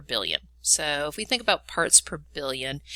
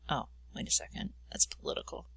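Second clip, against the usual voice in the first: whispered aside; 4 semitones lower, 12 dB quieter than usual. Real, not acted. Joking aside about state line.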